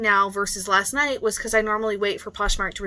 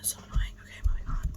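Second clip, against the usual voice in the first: unvoiced weary whisper; 8 dB quieter, faster than usual. Real, not acted. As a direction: drop to a tired whisper. Drained aside.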